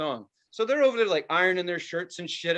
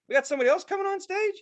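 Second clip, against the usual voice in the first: much higher, fast, incredulous question; disbelief; 13 semitones higher, much faster than usual. Retold or imitated. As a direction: squeak it out in disbelief. Mock disbelief at encore.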